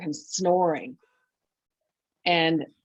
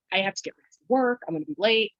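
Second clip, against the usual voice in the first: hurried quote; 3 semitones higher, much faster than usual. Retold or imitated. Rushing off to work.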